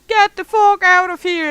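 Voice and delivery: loud, high shouted get out; screaming. Retold or imitated. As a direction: scream it at the top. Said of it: "one part of it is screaming".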